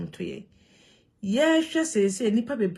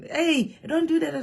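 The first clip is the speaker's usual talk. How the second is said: high, loud call to stop a fight; bystanders calling out; 10 semitones higher, 6 dB louder than usual. Retold or imitated. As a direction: shout them off the woman, high and loud.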